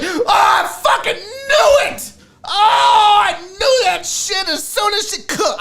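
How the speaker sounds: high, drawn-out full shout; hammed-up triumphant wail, loud and high; vindicated outburst. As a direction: yell it like you called it all along. Explosive reaction to hearing the name.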